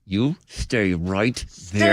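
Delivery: low, slow gritted-teeth warning; slow warning through clenched jaw. Acted. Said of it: "parent talk through your teeth thing".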